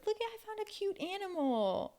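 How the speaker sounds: put-on high excited child. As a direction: coo like a little kid. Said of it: "children voiced".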